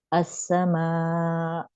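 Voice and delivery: अस्समा slow, voice stopped abruptly; hamza stop. Shown as a demonstration. Teacher showing students the sudden hamza stop. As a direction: cut the voice off at the end of अस्समा. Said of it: "मेरी आवाज रुक गई फौरन".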